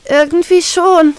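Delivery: put-on girl admitting to her captain she is hurt, sheepish; ashamed. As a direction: admit it with embarrassment. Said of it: "antworte ich beschämt".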